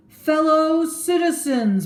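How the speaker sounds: high, loud and slow public call; oratory address. Acted. Brutus addressing the crowd in the marketplace. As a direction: open a speech to a crowd.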